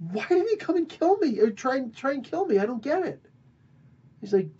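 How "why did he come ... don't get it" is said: pitched way up, confused; high bewildered voice. Retold or imitated. King baffled after the attempted killing, high bewildered voice. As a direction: squeak out the confusion.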